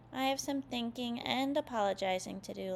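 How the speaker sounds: usual speaking voice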